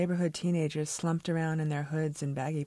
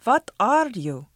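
put-on slow Eastern European accent, W for V, louder; a blunt question in a Dracula accent; 6 semitones higher, 7 dB louder, slower than usual. A narrator's reading. Mrs Betsky interrogating Inez in a Dracula accent. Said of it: "She had an accent like Dracula".

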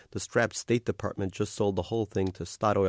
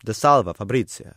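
plain reading voice

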